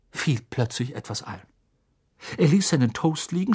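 ordinary delivery

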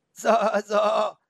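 high, loud bleat with wobbling vowels; bleating goat. A narrator's reading. Goat answers Felix in the forest, bleating. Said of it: "mit ihrer meckrigen Stimme".

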